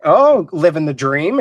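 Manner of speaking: normal talking voice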